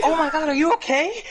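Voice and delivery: put-on high, loud alarmed girl's voice; worried Caroline. Caroline runs to the fallen player, alarmed high cry. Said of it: "Caroline's like".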